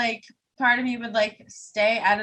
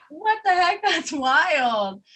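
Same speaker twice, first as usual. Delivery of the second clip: high, loud, amazed exclamation; mock shock; 9 semitones higher, 7 dB louder, much slower than usual. Listener reacting to murder podcast while washing dishes, mock-shocked. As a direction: exclaim in playful astonishment, pitched way up.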